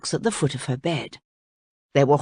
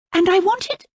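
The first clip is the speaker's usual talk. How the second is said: put-on high curious girl's question; young princess; 14 semitones higher than usual. A narrator's reading. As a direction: lift it up like a wide-eyed girl. Voices the princess.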